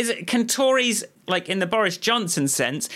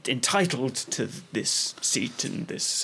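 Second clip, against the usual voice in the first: low, soft posh Tory drone; entitled Eton toff, pitched low; Boris Johnson impression; 6 semitones lower, 4 dB quieter than usual. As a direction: put on a plummy, self-assured posh voice. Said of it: "the Tories' to-, posh voice".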